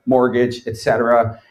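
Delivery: usual speaking voice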